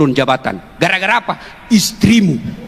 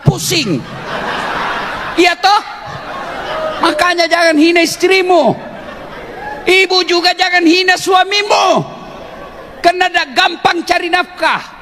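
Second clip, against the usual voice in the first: preaching at full force; 11 semitones higher than usual. Acted. Ustadz fires up the congregation about wives and husbands, at full force. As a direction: preach it hard to the crowd, rising. Preacher rises to fervent pitch.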